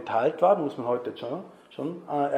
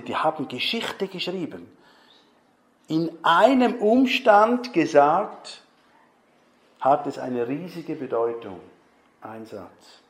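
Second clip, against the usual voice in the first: usual speaking voice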